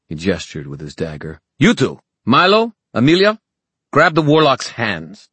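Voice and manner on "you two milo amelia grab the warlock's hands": loud, high-pitched commands; barked orders. Acted. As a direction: order your fighters to seize him. Villain orders his fighters about.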